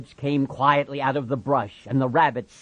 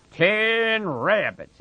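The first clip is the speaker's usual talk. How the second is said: slow, higher mocking sneer; scornful; 9 semitones higher, 4 dB louder, much slower than usual. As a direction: drag it out with contempt. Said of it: "it said scornfully".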